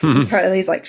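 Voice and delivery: ordinary delivery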